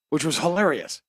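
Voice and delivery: ordinary delivery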